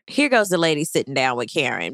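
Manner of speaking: normal talking voice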